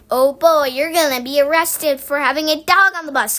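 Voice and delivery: loud, crowing taunt; gloating. A narrator's reading. Scooter to Henry about getting arrested, loud gloating. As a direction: crow gleefully over his bad luck. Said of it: "gloated Scooter".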